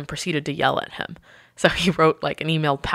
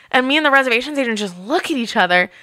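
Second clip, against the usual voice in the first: normal talking voice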